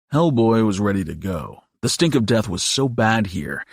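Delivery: usual speaking voice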